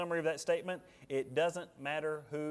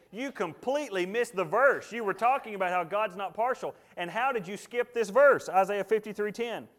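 higher, louder nagging rebuke; mock wife scolding; 7 semitones higher, 7 dB louder than usual. Preacher voicing his wife's complaint about the sermon, louder and higher. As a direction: play the wife pointing out his miss. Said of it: "And she said".

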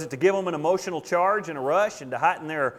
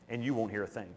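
ordinary talk

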